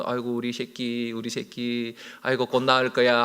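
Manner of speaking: put-on soft tender hush to a sick child; lightly voiced mother comforting her boy; gentle soothing murmur to a child.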